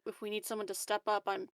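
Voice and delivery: normal talking voice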